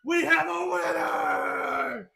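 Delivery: high, loud, drawn-out ring announcer; triumphant host yell, slow and high; announcer shout. Acted. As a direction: proclaim it to the whole arena, stretching the words. Host declares the winner to crowd.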